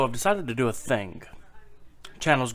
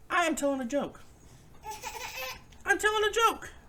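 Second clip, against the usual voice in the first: real aside in a raised, cooing pitch; high-pitched aside; 5 dB quieter than usual. Real, not acted. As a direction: tell the interrupter in a squeaky high pitch. Aside to interrupting child or pet.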